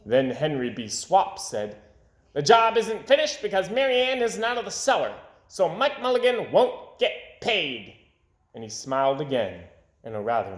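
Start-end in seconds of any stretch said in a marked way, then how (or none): 2.3-8.0 1 mean official. A narrator's reading. Henry B. Swap's mean remark.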